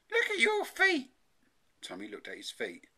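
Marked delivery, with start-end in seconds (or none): 0.1-1.1 high, loud mum voice; alarmed mother. A narrator's reading. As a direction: urge the child in high-pitched alarm. Alarmed mother shows son his feet.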